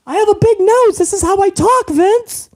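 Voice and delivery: whiny son-in-law answers the chairman; mock son-in-law. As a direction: plead back to the boss.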